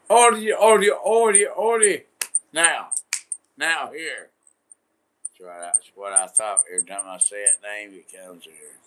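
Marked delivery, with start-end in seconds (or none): 0.1-4.3 high, loud repeated holler; loud, insistent high-pitched command; commanding call. Real, not acted. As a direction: shout for order in a much higher voice. Barking out calls to order.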